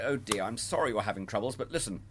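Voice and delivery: soft, gentle comforting Father Christmas; a soft kindly Santa; reassuring Santa. Shown as a demonstration. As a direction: comfort the child softly. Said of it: "you might say".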